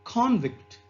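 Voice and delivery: "convict" stressed on CON; front-stressed noun "convict". Shown as a demonstration. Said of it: "And the noun".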